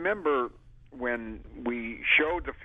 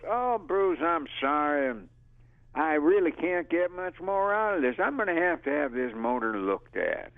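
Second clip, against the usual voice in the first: much higher, mock-innocent apology; fake-innocent Buford excuse, much higher; quoting Buford; 8 semitones higher than usual. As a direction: play Buford faking sorry. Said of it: "And he goes".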